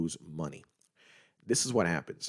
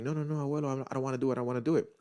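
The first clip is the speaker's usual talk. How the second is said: put-on scared child pleading; 6 semitones higher, much faster than usual. Scared kid pleading with grandfather.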